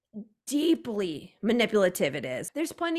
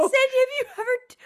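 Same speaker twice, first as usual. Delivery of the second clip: laughing quote; 14 semitones higher, faster than usual. Real, not acted. Breaks into laughter quoting.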